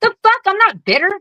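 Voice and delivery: sudden high-pitched outrage at the label; indignant disbelief. Real, not acted. To the hosts, scoffing high at being called bitter. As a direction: shoot the pitch up, offended and incredulous.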